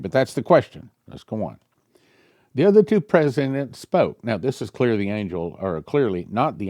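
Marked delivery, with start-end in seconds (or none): none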